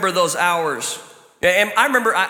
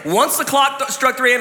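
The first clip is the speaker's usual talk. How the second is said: ordinary talk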